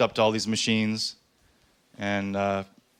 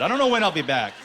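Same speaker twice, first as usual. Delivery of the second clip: high, raised-voice retort; defiant outburst; 5 semitones higher, 4 dB louder, faster than usual. Retold or imitated. Comic retelling his exit for a bike ride, defiant. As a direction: storm off with a mock-defiant cry.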